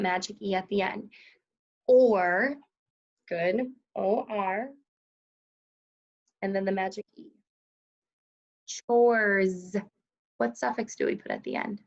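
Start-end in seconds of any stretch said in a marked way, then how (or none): none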